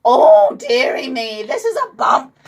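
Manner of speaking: high-pitched fussing nurse voice; fussing old nurse. A narrator's reading. Nurse fussing over the boy's head, high-pitched. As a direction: fuss over the bump in a high voice.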